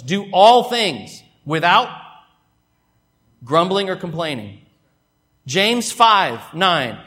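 slow, loud, weighty scripture reading. Acted. Preacher reading scripture aloud to the church, slow and loud. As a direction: declaim the verse to the congregation.

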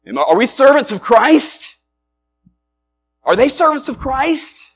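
high, loud challenge rising at the end; incredulous trash talk. Acted. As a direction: throw the question out like a taunt. Acting out Paul's smack talk.

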